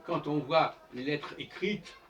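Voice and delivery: ordinary talk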